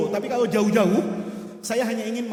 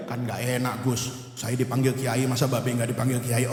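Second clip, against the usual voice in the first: lower, lightly voiced pupil pleading; student's reply; 12 semitones lower than usual. Preacher voices his student explaining why his father is called kiai, low pleading. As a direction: play the embarrassed student, voice dropped low.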